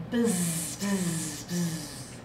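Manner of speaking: low, fading bee buzz. Shown as a demonstration. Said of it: "I opened up my noisy box and I took out".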